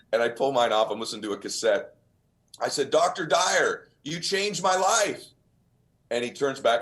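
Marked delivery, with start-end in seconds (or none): 2.9-5.2 retold excited greeting. Young fan greets Wayne Dyer.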